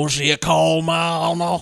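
slow, drawn-out mock Southern Clarice accent; Clarice Starling accent. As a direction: stretch it out like a bad Clarice Starling impression. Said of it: "his impression of Clarice".

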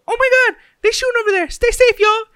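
very high, very loud squealing warning; shrieking girls. Retold or imitated. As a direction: squeal it out the truck window. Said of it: "they're like".

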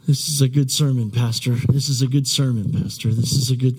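low, quick-fire muttered pep talk to yourself; mock self-encouragement. Pastor jokingly praising his own sermon to himself, low self-encouragement. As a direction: repeat it low, like private reassurance. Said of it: "this is what I'm telling myself".

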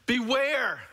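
high, loud mock shout of the warning; Jesus shouting a warning to us. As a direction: call out the warning urgently. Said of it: "Jesus is shouting at us".

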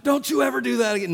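hammed-up scold of a little boy; mock scolding. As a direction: snap at the kid in pretend outrage. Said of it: "Let's fuss at that five-year-old".

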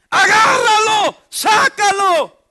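loud, high, drawn-out yelling; urgent shout. Retold or imitated. Friends on the shore yelling for a rescue, loud and stretched. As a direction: call out across the beach, slowly and urgently.

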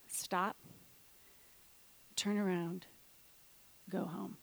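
eerie hushed warning; mysterious voice. Retold or imitated. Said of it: "and the voice says".

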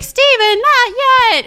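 high, loud imitation of calling out; very high-pitched retold cry; mock urgent call. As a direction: call him off, theatrically. Retelling the medium calling out.

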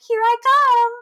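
high, loud, sing-out announcement; gleeful giant spider. Acted. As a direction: call it out high and loud as you charge in. Voicing giant spider rolling in.

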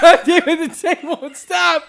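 high cursing with laughter in it; exasperated yell. Acted. Son fighting the tape player, exasperated laughing yell. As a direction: cry out as the machine runs away from you.

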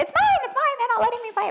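high, whining kid imitation; mock the kids fighting over a toy; a child whining that it's not fair; whiny child voice. Mimicking squabbling kids over a toy.

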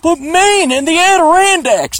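high, drawn-out mock whine; exaggerated high imitation of objectors; mocking protest. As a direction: tease them with a slow, high-pitched objection. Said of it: "I'm giving you guys shit".

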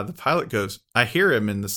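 normal talking voice

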